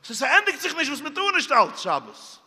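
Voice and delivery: high, loud burst of exasperation; retold exasperation. Retold exasperated end of list. (אויפגערעגט, מיט א הויך קול)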